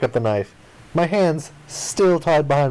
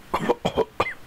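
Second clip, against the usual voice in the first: fake cough; 5 dB quieter than usual. Choking after being kicked.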